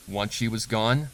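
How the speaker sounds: ordinary delivery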